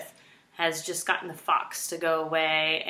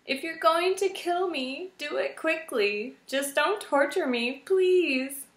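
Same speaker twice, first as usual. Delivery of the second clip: tearful pleading; 9 semitones higher than usual. A narrator's reading. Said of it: "Poppy gazed up tearfully".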